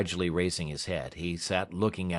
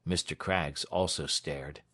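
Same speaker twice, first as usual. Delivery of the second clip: ordinary delivery, nothing marked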